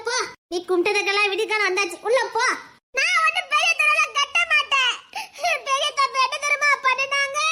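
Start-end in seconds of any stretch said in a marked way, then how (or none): 3.0-7.4 high, wailing refusal; high-pitched crying complaint; tearful protest. Acted. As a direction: bawl out your objection, pitched high. Girl protests the arranged match.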